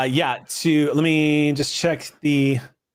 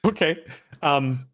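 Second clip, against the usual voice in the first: ordinary delivery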